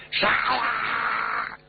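high, drawn-out charge yell; acted distant-crowd war shout; battle cry. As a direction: call the troops to the attack. Soldiers yelling in an ambush.

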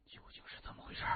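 tense, barely audible whisper; low-voiced question. A narrator's reading. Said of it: "低声问". (压低声音悄声问)

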